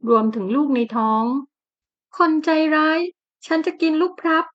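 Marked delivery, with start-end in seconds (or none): 1.7-4.5 pitched-up weepy scolding; sobbing complaint. Acted. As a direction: whine it out through sobs like a hurt woman. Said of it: "หล่อนต่อว่าสะอื้น".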